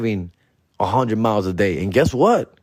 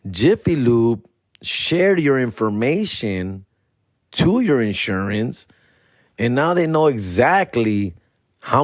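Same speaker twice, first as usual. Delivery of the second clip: slow, deliberate broker explanation; slow explaining; slower than usual. Retold or imitated. Broker slowly explaining the leak to the caller. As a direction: spell it out slowly. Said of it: "She like".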